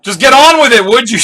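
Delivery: loud, high impatient outburst; acted-out irritated shout; yelling. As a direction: cut in with an exasperated yell. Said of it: "Zoro's yelling at him".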